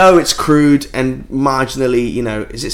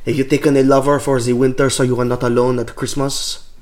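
put-on seductive French accent. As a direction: woo them in a French accent. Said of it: "your impression of a Frenchman".

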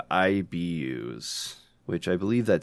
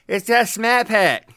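much higher, louder hammed-up commercial voice; Jim Krasinski ad voice; 12 semitones higher, 6 dB louder than usual. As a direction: ham up a car commercial line. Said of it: "And he's like".

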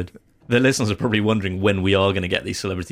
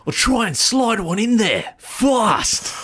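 hammed-up accent, very high and drawn out; Shane Warne; 14 semitones higher, 4 dB louder, much slower than usual. Bowler announcing his next delivery, exaggerated bad accent. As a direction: overdo the accent badly, stretching every word slowly. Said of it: "a hint of an accent in there".

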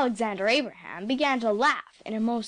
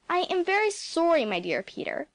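impressive; 7 semitones higher than usual. A narrator's reading. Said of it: "said the doctor impressively".

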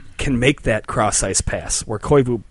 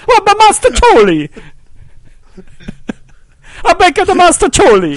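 high, loud hammy Italian voice; terrible Italian accent; 8 dB louder than usual. Pretend. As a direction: overdo a fake Italian accent. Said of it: "terrible Italian imitation".

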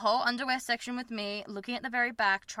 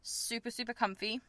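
ordinary talk, nothing marked

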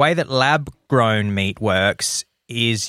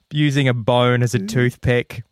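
normal talking voice

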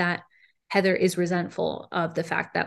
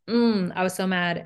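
normal talking voice

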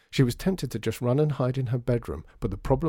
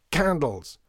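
higher, raised one-word order; impatient shout; 5 semitones higher, 4 dB louder than usual. A narrator's reading. Shopkeeper naming goods for child in storeroom, shouting. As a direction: call it out insistently.

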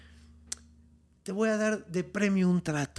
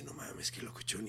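stunned whisper; 7 semitones lower, 16 dB quieter than usual. Retold or imitated. Stunned miner muttering alone.

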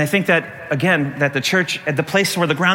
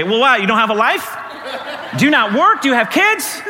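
high, rising sarcastic questions; mock incredulity; 6 semitones higher than usual. Preacher to person bragging of twenty chapters, mock incredulous. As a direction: challenge them in comic disbelief, pitched up. Mock retort to devotional over-sharer.

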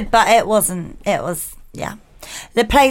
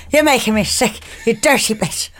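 ordinary talk

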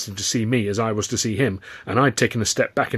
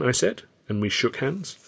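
ordinary delivery, nothing marked

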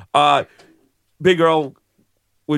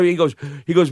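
ordinary talk, nothing marked

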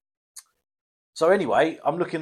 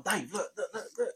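urgent hush; 5 semitones higher, 12 dB quieter than usual. Retold or imitated. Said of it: "I'm shaking his shoulder".

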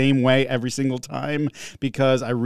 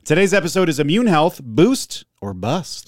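episode title announcement; 5 semitones higher than usual. Acted.

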